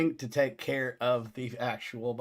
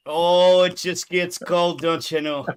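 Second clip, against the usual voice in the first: playful Minnesotan drawl, louder; mock Minnesotan accent; 5 semitones higher, 9 dB louder than usual. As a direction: drag the O's Minnesota style. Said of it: "too much Minnesotan".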